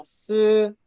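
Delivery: S held level and high; S on one high flat pitch; first tone. Shown as a demonstration. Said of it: "el primer tono". (S en primer tono)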